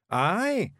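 high, proud Scots aye; proud Feegle. A narrator's reading. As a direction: Rob proudly answers Miss Level, high Feegle. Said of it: "said Rob proudly".

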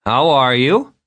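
high, rising drawn-out well; tipsy visitor at the door; strange Vern greeting. Acted. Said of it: "Vern said".

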